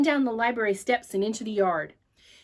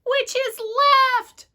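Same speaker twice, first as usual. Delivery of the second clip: lightly voiced girl calling back; a small girl's high puzzled call; young sister calling from above; 6 dB louder than usual. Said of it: "she called back".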